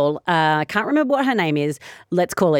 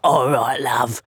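low, put-on mum voice; deep, easy-going mother; deeper mum voice; 5 semitones lower, slower than usual. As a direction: drop right down for the mum. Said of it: "she's got an even deeper voice".

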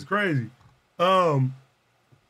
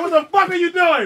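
higher, louder shocked yell; mock shout; 8 semitones higher, 5 dB louder than usual. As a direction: yell in disbelief at him. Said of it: "the girl like".

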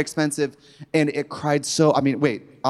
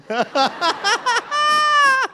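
high shouted fake cry; mock sob. After the massage, high wailing mock sob. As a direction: burst into a hammed-up wail. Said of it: "I was like".

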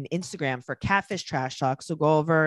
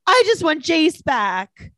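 higher, slow whining quote; whiny impression; 10 semitones higher, 5 dB louder, slower than usual. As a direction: drag it out like a whine. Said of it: "she would be like".

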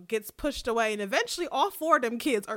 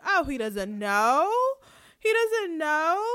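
mock taunt, louder, climbing high; 6 semitones higher, 5 dB louder than usual. Aemond taunting the boys about their father, drawn-out mock taunt. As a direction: draw the question out and up to needle them. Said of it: "Aemon's taunting them".

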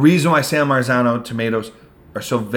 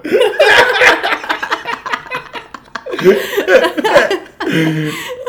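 long, high burst of laughter; big laugh. Real, not acted. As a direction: crack up at your own rant. Laughing at own rant.

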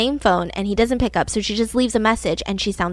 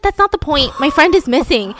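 higher, louder exasperated retort; frustrated; 4 semitones higher, 5 dB louder than usual. Retold or imitated. Friend insists to embassy staff, frustrated and louder. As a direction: push back hard at the officials.

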